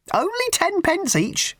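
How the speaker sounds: very high, slow, sugary raffle-lady voice; a prim, simpering old charity lady pushing tickets; high twittering church-fete lady; raffle woman. Acted. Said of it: "she said".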